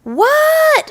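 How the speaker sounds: high, loud yelled question; loud shout. A narrator's reading. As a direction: yell back without thinking. Froggy answering a shout.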